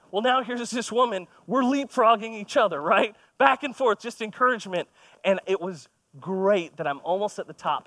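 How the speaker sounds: ordinary talk, nothing marked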